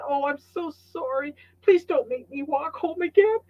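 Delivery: high-pitched pleading apology; exaggerated begging at everyday volume; mock apology. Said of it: "I apologized quite loudly".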